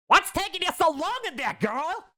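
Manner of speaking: put-on muffled older woman. Stylist knocking on Yancy's door, peeved muffled call. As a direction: call through the door, annoyed. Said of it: "came the muffled voice of an older woman".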